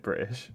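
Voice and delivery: "British" with the T left out; "British" with a catch in place of the T; dropped T. Shown as a demonstration. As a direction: say "British" as "Bri'ish", like most British people. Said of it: "most of us would actually say it".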